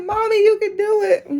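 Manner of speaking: put-on high, childlike cheer of a little boy; imitating son. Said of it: "He like".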